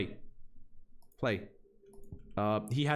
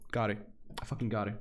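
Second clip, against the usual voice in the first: ordinary talk, nothing marked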